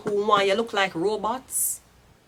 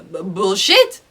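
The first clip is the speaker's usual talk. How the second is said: louder "bullshit" with a rising end; scoffing, louder dismissal; 8 dB louder, slower than usual. Real, not acted. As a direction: call it out, louder. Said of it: "because your accent, bullshit".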